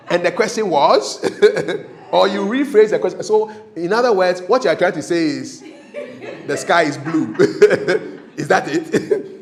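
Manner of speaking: loud, amused, talking through laughter; laughing. Real, not acted. Emcee teasing a rambling questioner, laughing loudly. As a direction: let real laughs break between the phrases.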